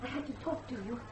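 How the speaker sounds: a secret plea barely above a whisper; girl slipping out at night to warn the guest, hushed and urgent. Acted.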